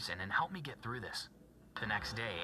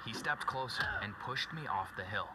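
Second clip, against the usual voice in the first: plain reading voice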